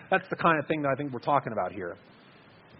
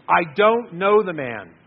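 slow, louder, each word pushed out; forceful denial; 2 semitones higher, 7 dB louder, much slower than usual. Acted. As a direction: deny it firmly, word by word. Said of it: "Peter got a little more forceful in his denial each time".

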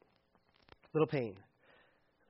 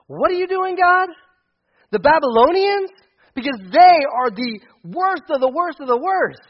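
much higher and louder, incredulous; outraged disbelief; 8 semitones higher, 9 dB louder than usual. Acted. Habakkuk protesting God's choice of the Babylonians, incredulous and loud. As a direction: protest like you can't believe it.

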